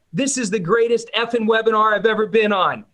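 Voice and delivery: high, hammed-up comment reading; mock viewer rave. Webinar viewer commenting on the overheard fight, high mock rave.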